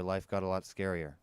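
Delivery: usual speaking voice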